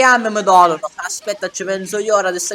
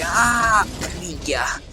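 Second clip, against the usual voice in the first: ordinary delivery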